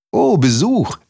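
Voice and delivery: high-pitched pleased exclamation; happy cry, pitch leaping up; delighted call. A narrator's reading. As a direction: greet an unexpected guest with surprise. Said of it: "rief der Maulwurf".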